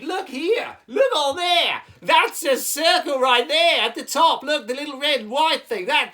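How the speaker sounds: high, very loud Aussie shouting; frenzied hammed-up Aussie; overexcited Australian puppet. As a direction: yell on, unable to contain the excitement. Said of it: "calm down".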